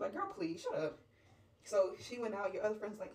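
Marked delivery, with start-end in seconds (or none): 0.1-1.0 high-pitched brush-off; dismissive. Acted. Friend answering back in a quarrel, dismissive. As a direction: wave her away, voice pitched up.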